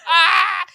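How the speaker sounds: sudden loud high scream; panicked shriek of someone in fear; retold scream. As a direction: let out a full terrified scream. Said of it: "I screamed straight into Mike".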